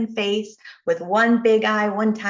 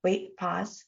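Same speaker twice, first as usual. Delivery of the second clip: calm self-talk; 3 semitones lower, 10 dB quieter than usual. Retold or imitated. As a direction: remind yourself softly. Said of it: "I go".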